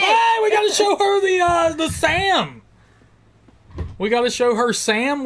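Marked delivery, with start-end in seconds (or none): 0.0-2.6 high, loud excited holler; calling out. Real, not acted. Calling Donna Dad over to show her Sam, hollering. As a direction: shout it up high to someone across the way.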